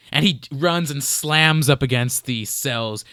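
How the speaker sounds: ordinary talk, nothing marked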